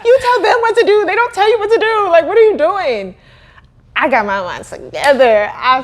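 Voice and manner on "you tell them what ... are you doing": laughing pep talk. Retold or imitated. Laughing retelling of self-talk.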